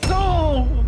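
loud high no; startled scream. Real, not acted. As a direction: jump at the sudden scare. Startled by an attacker.

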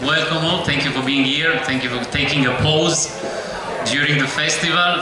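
ordinary talk, nothing marked

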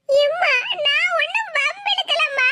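high, slow, put-upon whine; wheedling daughter pleading not guilty; whining denial. Acted. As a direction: protest innocence, dragging the words. Daughter whines denial to scolding mother.

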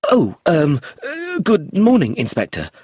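high, halting, stammered greeting; frightened tutor. Acted. Tutor greeting the inspector, frightened and halting. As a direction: stumble over the greeting in fear. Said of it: "looking very frightened".

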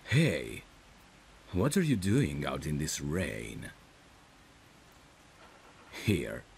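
usual speaking voice

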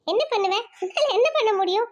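ordinary delivery

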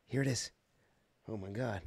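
ordinary talk

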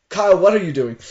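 loud, high call to a friend. Real, not acted. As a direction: yell his name. Calls out at friend.

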